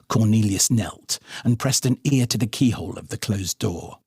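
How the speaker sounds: ordinary delivery, nothing marked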